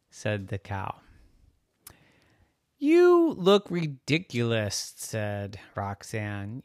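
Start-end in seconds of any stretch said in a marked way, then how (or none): 2.8-4.9 high, slow, loud scoff; incredulous little girl; scornful girl. Acted. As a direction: draw it out in a high, mocking kid voice.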